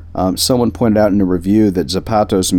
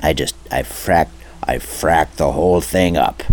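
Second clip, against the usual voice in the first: botched impression voice. Shown as a demonstration. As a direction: stay in the failed character voice. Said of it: "I'm getting my S's all messed up".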